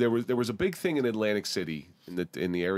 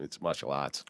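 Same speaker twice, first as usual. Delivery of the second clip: imitating Boston martial arts; Boston accent; 5 semitones lower, 4 dB quieter than usual. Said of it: "Every time Lexi says something".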